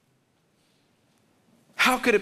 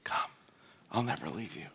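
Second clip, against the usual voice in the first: hushed, much softer invitation; soft whisper of God; 6 semitones lower, 11 dB quieter than usual. Shown as a demonstration. Preacher voicing God's soft call to the lonely, hushed. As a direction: say it gently, barely above a breath. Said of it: "actually whispering".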